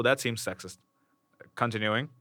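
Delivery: ordinary delivery, nothing marked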